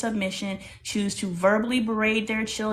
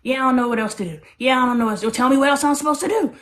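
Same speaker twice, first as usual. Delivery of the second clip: retelling her own helpless protest, rising and louder, rushed at first; exasperated pleading; 3 semitones higher, 5 dB louder, much faster than usual. Retold or imitated. Mother answering her daughter's criticism, mounting exasperated plea. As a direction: build from fast frustration to a loud, high plea. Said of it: "And I'm like".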